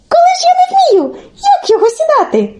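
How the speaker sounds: put-on very high, piping child-like voice; naive Telesyk. Telesyk asks Olenka how to sit, high and naive. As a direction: play the innocent who cannot understand.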